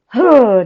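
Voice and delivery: loud, forceful "huh"; energy grunt. Shown as a demonstration. Coach cueing the group, a loud forceful "huh". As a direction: fire out a punchy burst of energy. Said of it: "could you give me a".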